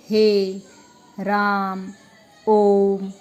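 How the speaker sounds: हे, राम, ओम each held long on a level note; drawn-out हे... राम... ओम, steady pitch; prolonged vowels. Shown as a demonstration. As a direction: stretch the vowel in हे, राम and ओम, with pauses between. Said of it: "उच्चारण ज़्यादा लंबा जा रहा है".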